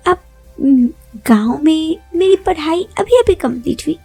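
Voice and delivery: ordinary delivery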